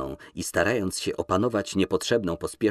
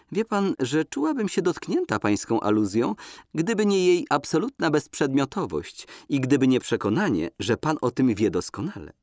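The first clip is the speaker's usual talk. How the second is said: higher, breezy and dismissive; lightly voiced young woman; 6 semitones higher than usual. Woman shrugging off his insinuation, breezy and higher. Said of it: "Zaśmiała się swobodnie".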